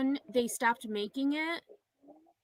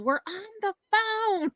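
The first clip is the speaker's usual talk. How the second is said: higher-pitched mock yell to the household; retold yell; 10 semitones higher, 4 dB louder than usual. Sisters yelling through the house during dial-up, high mock yell. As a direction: call it out high, like an annoyed sibling. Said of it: "my sister and I would yell".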